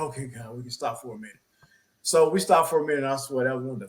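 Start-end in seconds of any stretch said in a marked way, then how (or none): 0.0-1.4 soft, low-voiced plea to God; quiet prayer. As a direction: murmur it like a tired prayer. Breathless prayer at red light.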